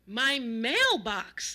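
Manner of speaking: slow, loud, drawn-out exasperation; loud exasperated old man; exasperated shout. A narrator's reading. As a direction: stretch it out, fed up. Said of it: "shouted in an exasperated voice".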